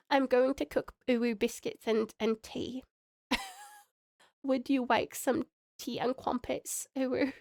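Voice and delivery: attempted British mum with babyish w-for-r sounds; British uwu baby-talk mum. Streamer doing requested redeems, British uwu baby-talk voice. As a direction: mix a British accent with cutesy baby talk. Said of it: "mum voice, a British accent, and an uwu baby".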